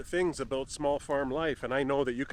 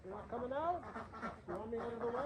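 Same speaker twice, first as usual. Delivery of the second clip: soft pet talk; 7 semitones higher, 12 dB quieter, much faster than usual. Real, not acted. Coaxing shy ducks softly.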